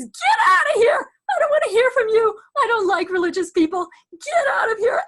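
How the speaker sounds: loud, high-pitched shouted rant; acted-out furious rejection; angry tirade. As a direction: yell to drive the visitor from the room. Patient rants at religious visitor.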